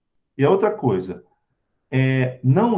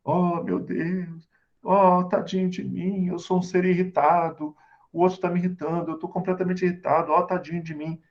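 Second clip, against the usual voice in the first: self-pitying victim; 2 semitones higher than usual. Acted. Speaker mimics someone irritated who pities himself, self-pitying. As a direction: play the poor victim, stretching out the sighing words. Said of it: "que nós chamamos de o vitimismo".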